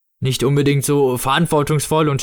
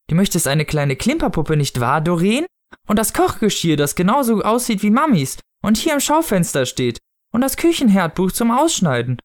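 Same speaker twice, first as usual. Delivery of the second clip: higher, sing-along coaxing mother; conspiratorial parent-to-child tone; 7 semitones higher than usual. A narrator's reading. Mother prompting her child before the Snow Father, coaxing high tone. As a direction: prompt the child with leading, lilting phrases. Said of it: "sprach in jenem Tonfall, den Erwachsene benutzen".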